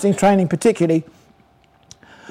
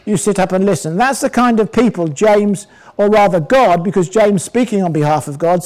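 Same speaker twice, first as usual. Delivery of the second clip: ordinary talk, nothing marked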